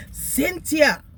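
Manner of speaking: high, loud sing-song call of the name; loud high imitation of the boss calling; manager calling my name. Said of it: "That's how she call my name".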